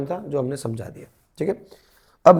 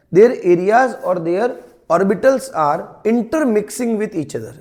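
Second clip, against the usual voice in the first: slow dictation; 2 semitones higher, much slower than usual. Shown as a demonstration. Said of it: "dictating definition to students".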